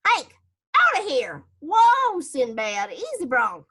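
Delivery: yelling. Acted. Cowboy Slim yells at Hank and calms his bucking colt. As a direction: holler at the dog while fighting the colt. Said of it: "he started yelling".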